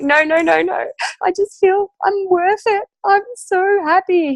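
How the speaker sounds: high-pitched, tearful happy protest; crying with joy. Retold or imitated. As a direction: blurt through happy tears. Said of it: "And I started to cry".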